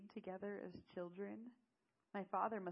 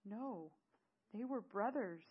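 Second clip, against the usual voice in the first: higher, louder, desperate reply; voiced desperation, pushed out rather than whispered; 4 semitones higher, 7 dB louder, slower than usual. Answering Eamon after learning of the brothers, desperate. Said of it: "with desperation and whispered".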